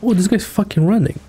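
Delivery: ordinary talk, nothing marked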